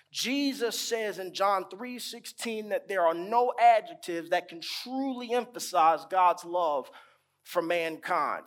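ordinary talk

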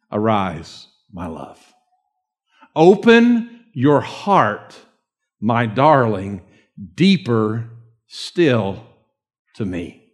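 tender reading. Acted. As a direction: draw each phrase out tenderly. Preacher reads Song of Songs tenderly.

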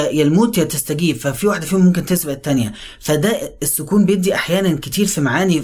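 ordinary talk

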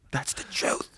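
whispered, voiceless and soft; whispering. Shown as a demonstration. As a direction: breathe it as a secret. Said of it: "whispered repeat".

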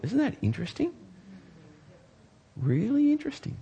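high, wondering marvel; marvelling wonder. Real, not acted. Pastor marvelling at God calling Nebuchadnezzar servant, high and wondering. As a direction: let the wonder lift the voice high.

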